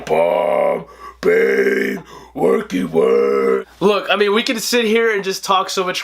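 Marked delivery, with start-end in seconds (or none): 0.0-3.7 very low slow grunting monster; dumb Frankenstein brute. Retold or imitated. Reviewer mocking Bane as Frankenstein, deep slow grunts. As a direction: plod it out like a brute. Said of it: "this Frankenstein going".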